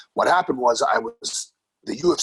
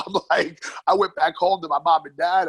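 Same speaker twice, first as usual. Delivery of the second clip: ordinary talk, nothing marked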